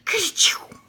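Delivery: big high sneeze sound. Acted. Rabbit hiding in the watering can, loud sneeze. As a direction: burst out a loud kerchoo. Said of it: "Then Peter sneezed".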